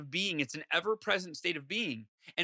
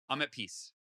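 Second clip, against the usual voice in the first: calm exhale; 5 semitones lower, 6 dB quieter than usual. Shown as a demonstration. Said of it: "take a deep breath and go".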